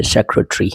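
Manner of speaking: सेक्रेटरी ending in "tree"; British "tree" ending. Shown as a demonstration. Teacher shows the ending read as tree, British pronunciation. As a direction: say सेक्रेटरी as "SEC-ruh-tree". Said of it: "ये ट्री पढ़ते हैं".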